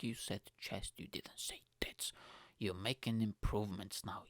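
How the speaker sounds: hushed mock self-praise; whispering. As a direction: whisper it to yourself. Mocking himself in inner voice.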